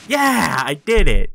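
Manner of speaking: loud, high victory yell; cheering at a win; triumphant shout. Real, not acted. Beats the obstacle.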